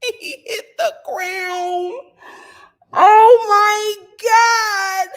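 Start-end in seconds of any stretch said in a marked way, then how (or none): 2.9-5.1 very slow, high and loud; shocked viewer reacting to a knockout; stunned gasp. Real, not acted. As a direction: drag it out in disbelief.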